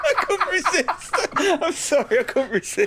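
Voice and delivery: high, helpless laughter through the words; laughing apology. Real, not acted. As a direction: apologise while cracking up. Apologises while cracking up.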